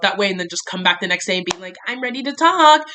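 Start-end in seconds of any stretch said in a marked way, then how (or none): 1.9-2.8 higher, louder, falsely bright; mock breezy. Mocking herself coming back next day, falsely bright. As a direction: mimic your own too-casual comeback.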